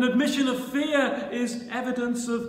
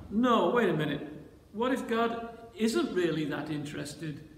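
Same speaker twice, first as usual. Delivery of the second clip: ordinary delivery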